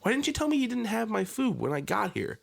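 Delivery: high-pitched mock yelling rant; retold rant. Ranting at the Popeyes worker about waiting, mock furious. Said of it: "I'm like".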